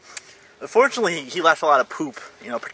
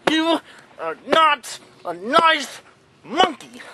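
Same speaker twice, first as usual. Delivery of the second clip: higher gleeful gloating; high mock triumph over the monkey; 10 semitones higher, slower than usual. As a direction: savor the hit like a cartoon villain. Gloating after hitting toy.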